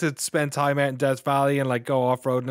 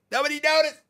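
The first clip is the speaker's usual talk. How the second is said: squeaky high voice; 5 dB louder, faster than usual. Pretend. Repeated, even higher.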